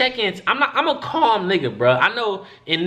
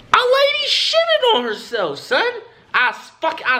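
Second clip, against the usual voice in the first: much higher, squealing astonishment with a laugh; shocked disbelief. Real, not acted. Telling friends about the waiting room lady, shrieking disbelief. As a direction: screech it like you can't believe it.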